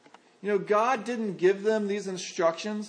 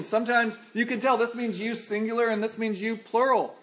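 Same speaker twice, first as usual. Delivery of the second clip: ordinary talk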